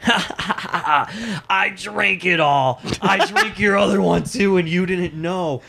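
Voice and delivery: put-on slow, giggly, babyish gloat; high childish laughing boast; stupid childish giggle. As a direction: taunt like a giggling naughty kid. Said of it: "he just gets this stupid childish".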